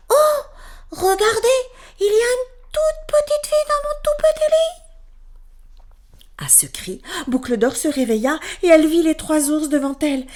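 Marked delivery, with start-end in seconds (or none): 0.1-4.8 very high, loud squeal; tiny voice crying out. A narrator's reading. Little bear spotting the girl in his bed, high loud cry. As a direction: shriek it like a startled tiny bear. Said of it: "cria de sa toute petite voix".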